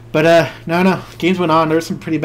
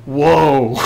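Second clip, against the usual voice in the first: loud, drawn-out mock whoa; amazed reaction; 8 dB louder than usual. Opponent sees the top three cards, loudly amazed. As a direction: act out an opponent's astonishment.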